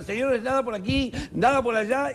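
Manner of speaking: showman magician. Acted. Magician starts third show.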